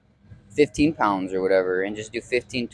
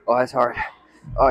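ordinary delivery

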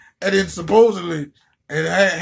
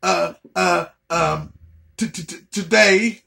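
imitating fake stutter; 4 semitones lower than usual. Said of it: "she had this fake stutter like".

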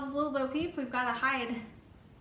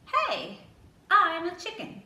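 high, loud squawky chicken; 6 semitones higher, 9 dB louder than usual. Acted. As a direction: play the proud chicken, higher and louder. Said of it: "He says".

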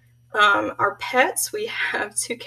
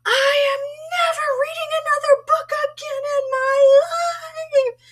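put-on high, loud tearful yell of a furious kid; much higher and louder, sobbing shout; retold crying scream; 6 dB louder than usual. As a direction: wail it like an eight-year-old who swears off books forever. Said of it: "and I screamed".

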